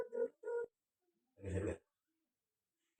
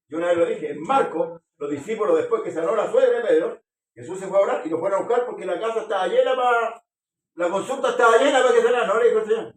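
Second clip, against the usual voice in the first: loud, higher preaching run; fervent preaching; 8 semitones higher, 11 dB louder than usual. Acted. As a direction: preach it with full fervor to the congregation. Preacher retells Mark with fervor. (predicación fervorosa a plena voz)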